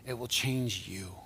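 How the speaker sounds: slow, soft, emphatic; slow promise. Real, not acted. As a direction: lean on change slowly.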